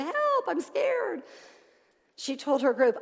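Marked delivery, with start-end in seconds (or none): 0.0-1.2 very high, loud, drawn-out wail, acted; scared cry for help. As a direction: cry out like Peter sinking in the water. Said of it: "like Peter did".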